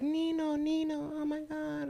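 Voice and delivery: very high sweet fawning voice; girly coworker voice. Retold or imitated. Goddaughter sweetly greeting her godfather, very high. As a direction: gush it way up high like a little girl.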